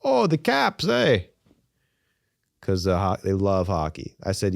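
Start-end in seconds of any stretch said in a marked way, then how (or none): 0.0-1.3 high Canadian voice with a drawn-out eh; higher lightly Canadian impression; Canadian accent. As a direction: imitate a cheery Canadian asking about the Caps. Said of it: "Everyone in the whole country".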